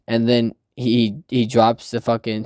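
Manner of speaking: normal talking voice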